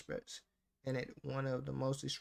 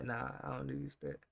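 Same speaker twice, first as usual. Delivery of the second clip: ordinary delivery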